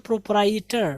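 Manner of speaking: प्रोप्राइटर in three syllables, "pro-prai-ter"; common mispronunciation. Shown as a demonstration. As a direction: drop the middle "e" from प्रोप्राइटर. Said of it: "हम लोग उसको बोलते हैं". (प्रोप्राइटर को "प्रो-प्राइ-टर")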